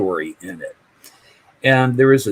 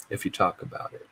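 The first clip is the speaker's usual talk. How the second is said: softer, hushed warning; whispered warning; 5 semitones lower, 11 dB quieter, faster than usual. Retold or imitated. Mother warning her son about prison, hushed whisper. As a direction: lean in and breathe the warning.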